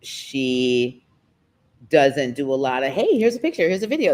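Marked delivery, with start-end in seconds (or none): 2.9-4.1 higher, chirpy imitation of herself sharing pictures; mock cheerful texter. To the host, imitating her own eager texts. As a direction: lightly play your own eager texting self. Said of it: "That's me, you know?".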